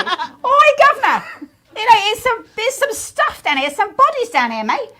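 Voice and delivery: put-on high, loud Cockney British accent; laughing Cockney British accent. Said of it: "This is British".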